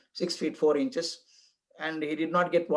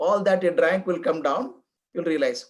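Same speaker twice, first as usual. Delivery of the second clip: normal talking voice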